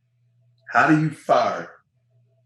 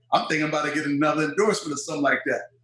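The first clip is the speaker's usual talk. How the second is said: ordinary talk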